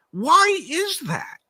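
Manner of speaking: high, slow, drawn-out why; emphatic rhetorical question. Real, not acted.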